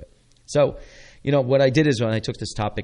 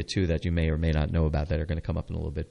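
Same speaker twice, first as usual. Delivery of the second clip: ordinary talk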